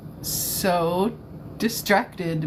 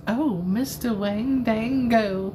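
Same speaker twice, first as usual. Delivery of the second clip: slow Southern swoon, higher pitched; 4 semitones higher, slower than usual. Pretend. Streamer repeating the name she heard in a Southern voice. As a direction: stretch it out in a playful Southern drawl. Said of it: "Why did I hear that in a southern voice?".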